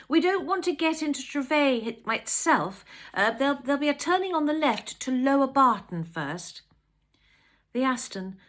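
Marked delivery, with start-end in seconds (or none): 0.1-6.6 higher, louder, calling over the wind; raised voice. Acted. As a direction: project to be heard above wind. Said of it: "raised her voice to be heard above the wind".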